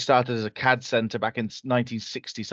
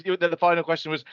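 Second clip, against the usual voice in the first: usual speaking voice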